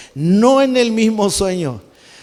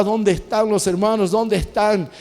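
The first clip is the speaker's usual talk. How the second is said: ordinary talk, nothing marked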